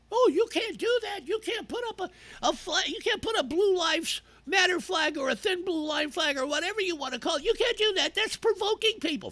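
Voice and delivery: whiny falsetto mimicry; mocking objector. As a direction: parody a scold in a very high voice. Mimics people objecting to his flags.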